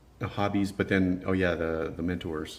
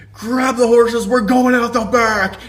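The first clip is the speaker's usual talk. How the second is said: very loud, high rallying holler; mimicked yell; 12 dB louder, slower than usual. Guy smashes glass then flees restaurant, mimicked yell. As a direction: holler it like a wild getaway order. Guy yells before running out.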